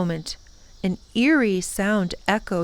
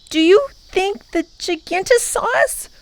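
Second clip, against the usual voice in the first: put-on boy voice; 11 semitones higher than usual. A narrator's reading. Boy asks nervously.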